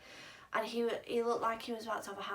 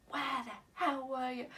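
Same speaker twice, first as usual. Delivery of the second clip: annoyed mum; 3 semitones higher than usual. Retold or imitated. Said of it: "she was so annoyed".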